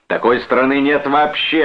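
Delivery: high, heated retort. A narrator's reading. Brother argues with his sister, heated. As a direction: fire back dismissively. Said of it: "ответил разгорячённый брат".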